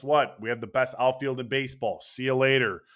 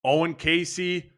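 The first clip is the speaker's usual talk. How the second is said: usual speaking voice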